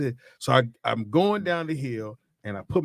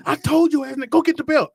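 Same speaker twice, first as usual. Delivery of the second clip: much higher, louder imitated yell of a furious dad; angry dad; 14 semitones higher, 7 dB louder than usual. Dad yelling at his son who broke his arm, imitated angry shout. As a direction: bark it like the angry father. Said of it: "He said".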